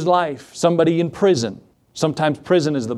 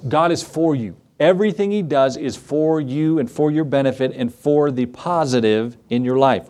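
ordinary talk

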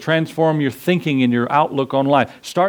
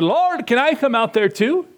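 high-pitched mock eagerness; imitating a cocky, excited request; 4 semitones higher than usual. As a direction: play an overeager Peter asking to join in. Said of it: "got a little cocky and excited and said".

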